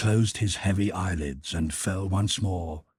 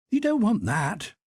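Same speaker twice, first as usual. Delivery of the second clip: put-on child voice. A narrator's reading. Boy speaks.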